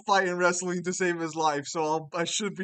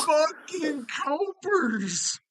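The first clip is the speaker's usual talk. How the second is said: outraged yell; 8 semitones higher, much slower than usual. Real, not acted. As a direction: stretch out the angry yell.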